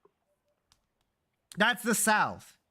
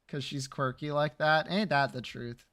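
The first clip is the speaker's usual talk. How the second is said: ordinary delivery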